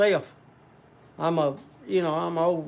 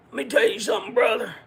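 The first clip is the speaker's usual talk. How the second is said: higher, put-on wrestler voice; Hulk Hogan; 5 semitones higher, faster than usual. Trying a wrestler he can't do well, put-on voice. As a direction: ham up an amateur wrestler impression. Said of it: "I can't do a good Hulk Hogan".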